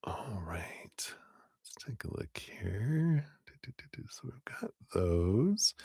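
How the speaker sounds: soft, slow whisper while reading the cards. Real, not acted. Tarot reader whispering to herself while checking cards, slow and hushed. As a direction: hush right down and murmur to yourself over the cards.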